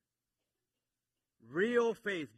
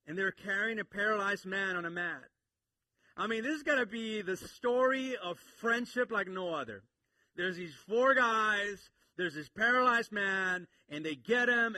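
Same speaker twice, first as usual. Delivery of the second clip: louder and higher, rising preacher's fervor; full-force preaching; 6 semitones higher, 8 dB louder than usual. Acted. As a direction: preach it out to the whole room.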